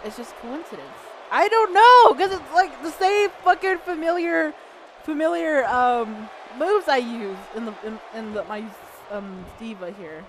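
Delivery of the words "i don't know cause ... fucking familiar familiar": loud, high burst of frustration; raised-voice exasperation; exasperated outburst. Real, not acted. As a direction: throw it out in exasperation. Exasperated at the game.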